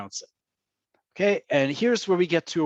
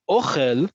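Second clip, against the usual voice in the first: "ochel" stressed on the O, OH-khel; first-syllable stress; 6 dB louder than usual. Shown as a demonstration. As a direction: hit the first syllable of "ochel", ending on a rise. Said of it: "emphasis is on the first syllable".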